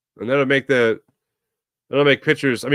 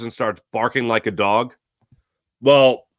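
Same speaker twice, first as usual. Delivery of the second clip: ordinary talk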